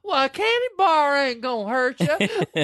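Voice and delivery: high, slow drawling tease; husband teasing. Retold or imitated. Retelling the husband teasing Evelyn, a slow high tease. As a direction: stretch the joke out dryly.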